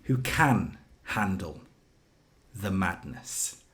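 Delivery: booming announcer build-up; ring announcer. Pretend. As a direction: announce him to the arena.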